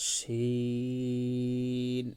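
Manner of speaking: শিন said very slowly and drawn out; Arabic letter demo. Shown as a demonstration. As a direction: hold শিন long and clear. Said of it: "আমরা উচ্চারণ করব". (ধীরে টেনে শিন উচ্চারণ)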